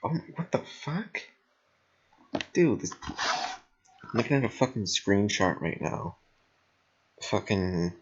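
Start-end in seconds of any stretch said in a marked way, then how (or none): none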